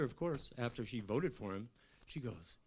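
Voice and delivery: ordinary talk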